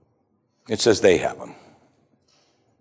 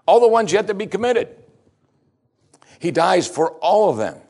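emphatic preaching; 6 semitones higher, 3 dB louder than usual. Acted. Preacher building to emphatic peak.

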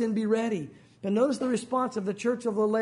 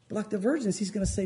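ordinary talk, nothing marked

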